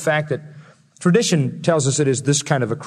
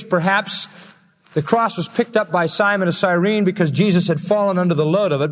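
usual speaking voice

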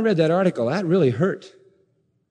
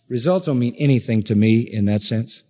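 ordinary talk

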